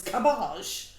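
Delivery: put-on posh French cabbage; mock French accent. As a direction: make cabbage grandly French, drawn out. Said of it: "like, French or posh".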